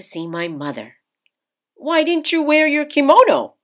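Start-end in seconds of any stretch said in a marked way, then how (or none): 1.7-3.6 higher, louder puzzled question; young man caught off guard; father asking about the kimono, surprised. A narrator's reading. Father's voice in story.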